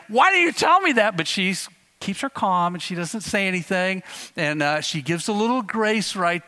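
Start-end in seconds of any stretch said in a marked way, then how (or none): 0.1-1.2 high, loud cry of disbelief; exasperated outburst. Retold or imitated. Teacher voicing frustration at the boy over his boots, loud exasperated cry. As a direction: blurt it out, fed up beyond patience. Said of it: "she's thinking about saying".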